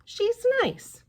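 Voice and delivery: put-on child sticking up for her pet; little Debbie. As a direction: say it like a small girl defending her cat. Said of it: "But Debbie said".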